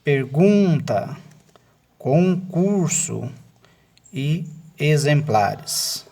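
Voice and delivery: slow, stretched-out word by word; deliberate classroom word list; reading words aloud. Shown as a demonstration. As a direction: read each word out very slowly, drawing out the stressed syllable. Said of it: "palavras em voz alta".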